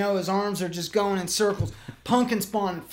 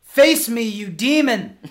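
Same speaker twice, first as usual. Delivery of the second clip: louder, higher challenge with a laugh; mock defiant yell; 4 semitones higher, 8 dB louder than usual. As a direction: shout it like a wounded hero. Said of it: "Which means".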